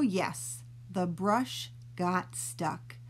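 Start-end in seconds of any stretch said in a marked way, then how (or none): none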